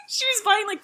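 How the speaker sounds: high, laughing through the words; real laughter bubbling into the telling. Real, not acted. Telling friend about the chaperone's shopping, laughing as she talks.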